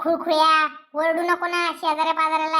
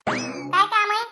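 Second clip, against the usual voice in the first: usual speaking voice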